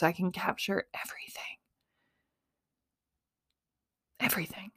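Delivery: soft unvoiced whisper; whispered emphasis. Real, not acted. Loving her phone camera, a dreamy whisper. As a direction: breathe it like a secret. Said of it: "whispered for effect".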